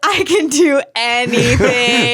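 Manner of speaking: slow, building proclamation; mock-triumphant declaration. Crew member proclaiming she can do anything, slow and rousing. Acted inner declaration of confidence.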